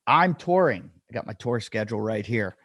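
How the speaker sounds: ordinary talk, nothing marked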